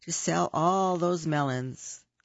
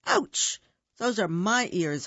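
sharp high yelp then complaint; high pained holler; 4 semitones higher than usual. Acted. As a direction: yelp it in pain. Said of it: "hollered Hare".

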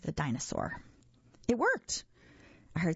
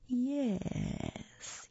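long hissed monster "yes"; higher, sibilant, eerie hiss; 7 semitones higher, 3 dB quieter than usual. A narrator's reading. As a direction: draw out the S like a snake. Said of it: "he hissed".

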